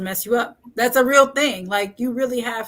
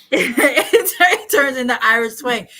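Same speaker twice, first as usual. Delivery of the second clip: laughing; 3 semitones higher, 8 dB louder, slower than usual. Real, not acted. Laughing at co-host's joke.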